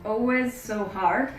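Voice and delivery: normal talking voice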